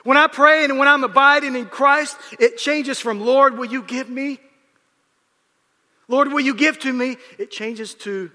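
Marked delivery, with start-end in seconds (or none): 0.0-7.2 high, loud sermon cry; preaching at full force. Acted. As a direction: lift the church with a ringing call.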